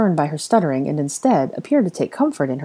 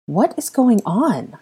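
uncomfortable; 7 semitones higher, much slower than usual. Acted. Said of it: "Hermione asked uncomfortably".

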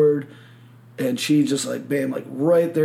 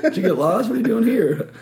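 higher, louder teasing replay; playful teasing; 7 semitones higher, 4 dB louder than usual. Retold or imitated. Repeating the woman's joke about him, playfully teasing. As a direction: lift the voice into an amused jab.

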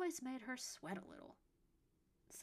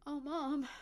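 hollow, forlorn moan; 6 semitones higher, 6 dB louder than usual. A narrator's reading. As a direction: sound empty and lost. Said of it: "his voice sounding forlorn and hollow".

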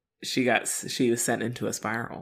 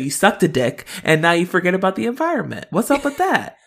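mock scolding rant; 3 semitones higher, 7 dB louder than usual. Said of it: "She's like".

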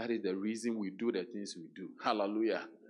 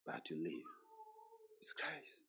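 hushed quote; 2 semitones lower, 12 dB quieter than usual. Retold or imitated. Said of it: "he said".

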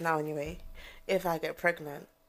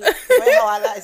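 high laughing start to the words; giggling while talking; 12 dB louder than usual. Real, not acted. Laughing about loving sleep.

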